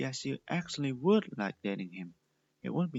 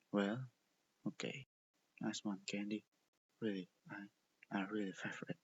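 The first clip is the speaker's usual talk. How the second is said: upset tone; 3 semitones lower, 8 dB quieter than usual. A narrator's reading. Boy hiding his hurt from Candy, softly upset. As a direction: lower and softer, hurt and withdrawn. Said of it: "an upset tone in his voice".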